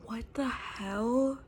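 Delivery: slow, stunned disbelief; barely getting the words out. Real, not acted. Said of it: "I'm gonna cry".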